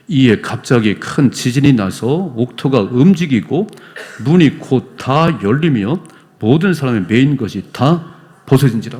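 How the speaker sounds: slow, loud unison Bible reading; congregational scripture reading. Real, not acted. Pastor leading church in reading, slow and loud. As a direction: read the verse aloud together, measured. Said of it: "이십육 절 같이 읽어봐요".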